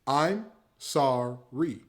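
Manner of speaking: "I'm sorry" said very slowly and simply; slow demo. Shown as a demonstration. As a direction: stretch out "I'm sorry" to show how short it is. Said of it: "Watch this".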